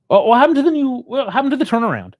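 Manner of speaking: real fan frustration boiling over; exasperated outburst. Real, not acted. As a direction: throw the question at the team.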